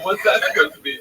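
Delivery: high, loud disbelieving question; incredulous. Retold or imitated. Retold indignant question.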